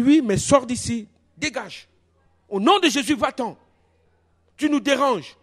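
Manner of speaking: retold stern dismissal; commanding rebuke. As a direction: send them away firmly, pitch up. Retold rebuke ordering woman away.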